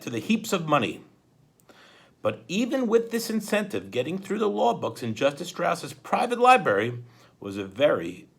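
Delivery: animated read-aloud for students; story narration. A narrator's reading. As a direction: read it like a dramatic children's book. Reads the novel aloud to students.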